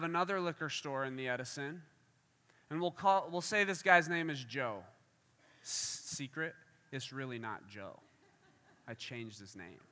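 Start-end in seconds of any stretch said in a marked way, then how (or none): 5.6-9.8 hushed, low, trailing-off aside; confidential aside. Pretend. Preacher confides the changed name to the crowd, confidential aside. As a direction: drop it right down like a secret.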